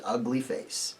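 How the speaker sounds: ordinary delivery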